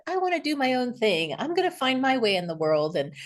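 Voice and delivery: lightly acted declaration of a young idealist; young self. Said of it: "around eighteen, nineteen, twenty, I was like".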